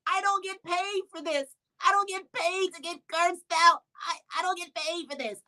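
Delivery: high, whiny fake sobbing; put-on crying plea. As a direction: ham up the tears to escape the call. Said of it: "I turned on my acting skills".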